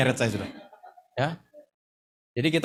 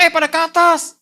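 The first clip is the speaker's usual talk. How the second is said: loud, high summons; retold calling out; 10 semitones higher, 8 dB louder than usual. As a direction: call up the people downstairs, re-enacted. Calling colleagues to come upstairs.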